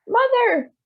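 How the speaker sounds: high, loud cry of Mother; shouting. A narrator's reading. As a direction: call out in amazement. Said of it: "shouted Missus Bucket".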